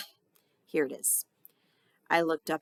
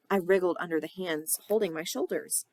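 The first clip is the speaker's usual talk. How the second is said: ordinary delivery, nothing marked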